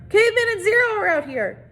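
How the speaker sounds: high calling out; shouted. A narrator's reading. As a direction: call back into the room. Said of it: "Squid shouted back into".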